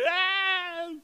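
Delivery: high, loud imitated crying wail; retelling the boy crying over his sunglasses; child's wail. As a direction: wail like a little boy in tears.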